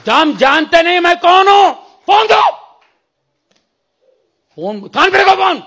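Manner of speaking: loud, high, commanding shout. Retold or imitated. Preacher demanding the phone from the brother, shouting. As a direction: yell down the phone with full authority. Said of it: "मैं चिल्ला रहा हूं".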